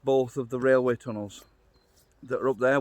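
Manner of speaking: normal talking voice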